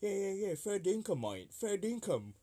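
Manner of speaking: high, mock Australian twang; a hint of Australian bloke; imitating Aussie colleague. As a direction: put on an Aussie accent, mate. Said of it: "some of my colleagues was like".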